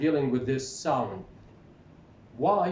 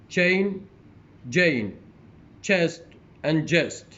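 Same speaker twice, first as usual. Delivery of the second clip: loud, separated words, rising then falling in pairs; teacher's model pronunciation, each word on its own; word-list reading; 3 semitones higher, 5 dB louder, much slower than usual. Shown as a demonstration. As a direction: read out minimal pairs clearly for a class. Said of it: "going to read these, the words".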